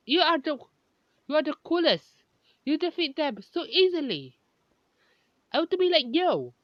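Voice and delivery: high, eager fan voices; excited kids. Acted. Students praising their teacher, high and excited. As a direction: gush like starstruck students.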